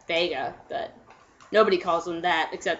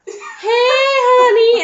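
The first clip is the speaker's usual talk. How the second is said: high, slow, sugary "hey honey"; sweet girlfriend voice; 14 semitones higher, 8 dB louder, much slower than usual. Retold or imitated. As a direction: coo it like a doting girlfriend. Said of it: "She's like".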